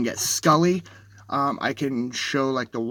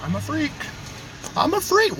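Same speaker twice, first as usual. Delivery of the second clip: excited high exclaim; 12 semitones higher than usual. Real, not acted. Excited at the sky.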